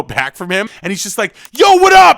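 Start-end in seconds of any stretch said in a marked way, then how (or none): 1.5-2.2 much higher, much louder "Yo, what up?"; [name] shout. Retold or imitated. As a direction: blast it out like all caps. Quoting [name]'s email.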